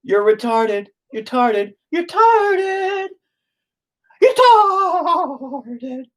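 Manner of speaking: very high squeaky cartoon voice; teasing falsetto stutter; funny voice. Shown as a demonstration. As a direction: squeak it out to make it sound funny. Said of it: "so it's okay if I make it sound funny".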